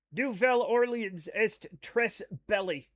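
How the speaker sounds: French butchered in an American accent; own American accent. Shown as a demonstration. Streamer mangling chat's French, deliberate American accent. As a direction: read French letter by letter as English, American accent. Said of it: "read things in my accent now".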